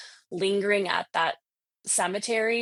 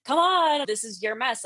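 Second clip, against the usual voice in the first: retold scolding; 6 semitones higher, 3 dB louder than usual. Retold friend scolding her.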